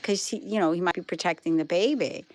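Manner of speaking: usual speaking voice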